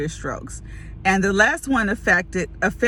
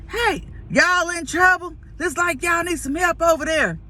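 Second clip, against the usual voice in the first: put-on little country boy; 8 semitones higher than usual. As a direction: imitate a small boy calling out to stranded strangers.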